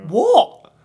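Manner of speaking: high, loud stunned what; sudden high startled yelp; shocked what. Retold or imitated. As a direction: blurt it out in disbelief. Shocked reaction in retold story.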